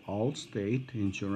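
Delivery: soft, low, measured words; careful word-by-word delivery; deliberately slow. Real, not acted. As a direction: spell it out slowly for the listener. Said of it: "I'm gonna go slow".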